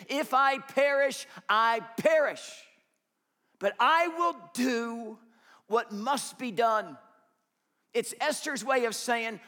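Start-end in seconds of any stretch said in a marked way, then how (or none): 0.1-7.0 firm resolve. Acted. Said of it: "she makes the decision".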